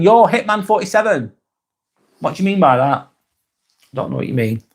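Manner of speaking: ordinary talk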